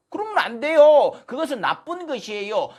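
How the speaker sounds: high, loud reprimand; loud scolding. Real, not acted. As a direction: burst out scolding the speaker. 연사를 꾸짖는 장면.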